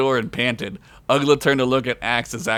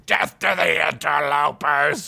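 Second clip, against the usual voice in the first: zombie shout; 2 semitones higher, slower than usual. Acted. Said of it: "shouted a zombie guy".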